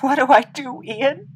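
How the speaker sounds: panicked plea. Acted. Pleading with dying friend.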